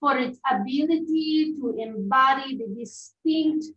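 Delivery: drawn-out put-on Italian accent. Reading wine notes to friends, slow mock Italian accent. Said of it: "should we read this in Italian accent".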